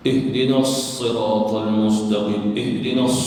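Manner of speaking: Ihdinas siratal mustaqim slow, with a long hissed s; slow, firm recitation of Ihdinas, s pressed; doubled sad. Shown as a demonstration. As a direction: hold the sad at the end of "Ihdinas". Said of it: "Di situnya ada huruf sad".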